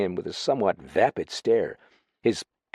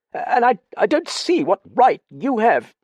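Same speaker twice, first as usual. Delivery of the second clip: high, louder, stammering protest; flustered objection; 14 semitones higher, 6 dB louder than usual. Acted. Suspicious Dallings objects to detective.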